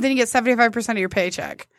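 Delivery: ordinary talk, nothing marked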